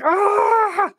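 high-pitched retold yelp of pain; mock yelp. Father retelling his covid swab, a high mock yelp. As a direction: squeal it like the swab hurts. Nasal swab test, squirming.

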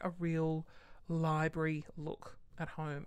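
ordinary talk, nothing marked

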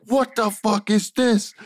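high, slow, exaggerated irritated yell; hammed-up outrage at the long script; mock angry demand. Wrestler retold demanding about the script, mock angry. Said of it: "And you were like".

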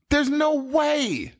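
high, drawn-out incredulity; slow, high-pitched disbelief; real disbelief. Real, not acted. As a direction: stretch it out, refusing to believe it. Disbelief at the misheard lyric.

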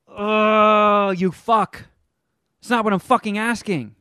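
retold angry comeback; exasperated outburst. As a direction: snap back in frustration.

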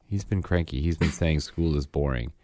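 normal talking voice

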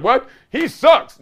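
mock disbelief. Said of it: "You're like".

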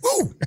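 high joyful woo; excited whoop. Real, not acted. [name] cheering, remembering a good moment, with an excited whoop. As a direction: let out a happy whoop. Ecstatic cheer recalling memory.

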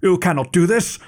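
high-pitched protesting shout; frantic scholar crying out, pitched well up; betrayed scholar protesting to the captain, high shout. Acted. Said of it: "the scholar shouted".

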